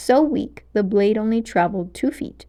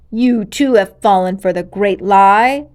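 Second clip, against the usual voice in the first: growling; 8 dB louder than usual. Acted. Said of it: "she managed to growl".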